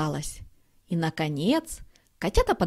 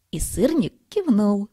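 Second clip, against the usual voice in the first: ordinary delivery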